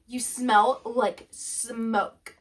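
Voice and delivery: very slow stern drawl; mom's slow disgusted accusation; slow disapproval. Retold or imitated. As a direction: draw out each word in disgust. Mom disapproving.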